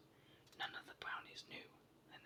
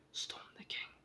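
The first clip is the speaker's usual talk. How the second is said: ordinary delivery, nothing marked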